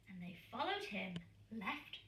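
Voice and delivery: ordinary delivery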